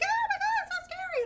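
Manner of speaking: very high, loud squealing panic; high-pitched mock terror; mock scared shriek. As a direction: shriek it like a terrified friend on a table. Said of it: "she was, like, screaming".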